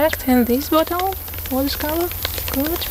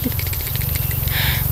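rapid whispered repeats; fast whispering; 4 dB quieter, much faster than usual. Acted. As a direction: whisper it quickly, close to the mic. Unvoiced rapid goods.

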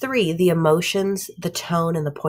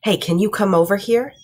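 louder, sharp mom summons; stern call from an angry mom; 3 semitones higher, 6 dB louder than usual. Shown as a demonstration. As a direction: call the kid over like trouble is coming. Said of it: "those same words and said".

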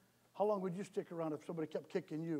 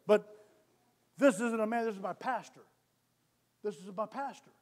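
ordinary talk

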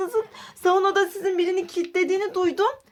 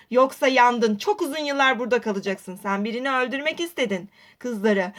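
deep police officer voice; 6 semitones lower than usual. Acted. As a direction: sound like a stern police officer. Polis hapse kilitliyor. (tutuklayan sert polis sesi)

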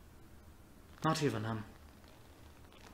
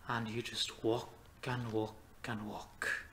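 slow, softer, trudging through each repeated walk; weary trudge; 7 dB quieter, slower than usual. Acted. Telling Sharky of endless lonely walking, weary trudge. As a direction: drag it out quietly, step by step. Weary aimless wandering described.

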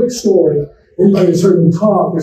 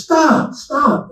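calling out; 9 semitones higher, 4 dB quieter than usual. Retold or imitated. Old patient calling the departing doctor back, high. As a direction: call out sharply, pitched high. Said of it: "he comes screaming at me".

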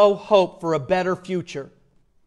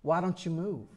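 soft, hushed plea with a light southern lilt; a quiet southern-drawled coaxing; hushed southern soldier; 10 dB quieter than usual. Retold or imitated. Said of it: "came to him silently and said".

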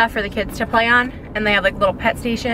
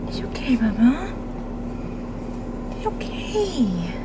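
soft, high, airy pet voice; soothing coo; 3 semitones higher, 6 dB quieter, slower than usual. Real, not acted. Owner calming her crying dog Papa, soothing coo.

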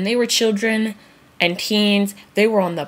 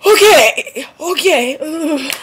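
recovering from laughter; 7 semitones higher, 5 dB louder, much slower than usual. Real, not acted.

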